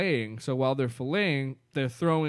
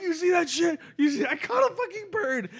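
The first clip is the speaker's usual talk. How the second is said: excited shout. Retold or imitated. Telling friends how Greg cheered catching a bird, excited shout. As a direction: yell it like you just landed a trophy. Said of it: "He's like".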